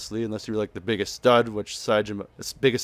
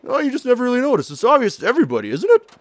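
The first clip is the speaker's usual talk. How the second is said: high, quick, hammed-up protest; put-on character reply; 13 semitones higher, 5 dB louder, faster than usual. Saejima answering her about noticing, high hammed-up voice. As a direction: pitch it way up and rush it, rising on the tag question.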